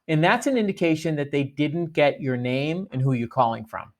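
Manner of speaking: ordinary talk, nothing marked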